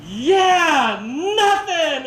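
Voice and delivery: imitating boys' loud, high retort; shouted group comeback. Speaker as Cub Scouts answering their leader, shouting. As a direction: holler back the cheeky answer. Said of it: "they all stand up and they go".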